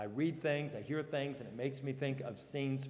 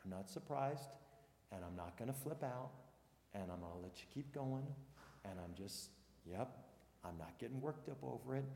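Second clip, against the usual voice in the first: softer, lower, easygoing reassurance; calm, unbothered; 4 semitones lower, 10 dB quieter, faster than usual. Retold or imitated. Pastor voicing God's patient reply to people, calm and unbothered. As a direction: shrug it off, totally at peace. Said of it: "God's like".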